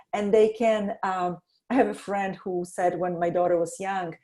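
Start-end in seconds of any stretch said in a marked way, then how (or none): none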